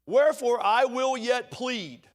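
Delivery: insistent, God refusing to be finished; voicing God pleading. Preacher voicing God who won't stop pleading. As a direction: press it like a promise. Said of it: "It's kind of what he's saying here".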